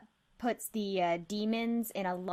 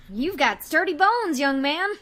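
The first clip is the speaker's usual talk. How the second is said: a hint of an old woman, loud and high; lightly voiced granny; approving old lady; 6 semitones higher, 7 dB louder than usual. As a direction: pat him on the back with words. NPC admiring the detective.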